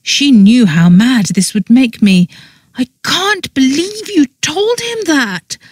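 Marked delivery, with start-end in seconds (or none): 2.8-5.6 very high, strained, softer disbelief; appalled squeal held under the breath; hissed outrage. A narrator's reading. Mortified woman to meddling friend, hissed outrage. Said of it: "I hissed at her".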